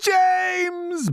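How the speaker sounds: high, loud, drawn-out bawl. A narrator's reading. Centipede calling the boy to save him, bawling. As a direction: bawl the name for help. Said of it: "bawled the centipede".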